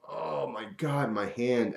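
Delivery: soft, slow mutter of pain; nursing the hand in secret; hushed hurt groan; quiet pained moan. Retold or imitated. Aching all day in secret.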